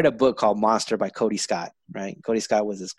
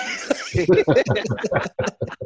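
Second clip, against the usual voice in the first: high laughing fit; 5 dB louder than usual. Real, not acted. Laughing at his own story.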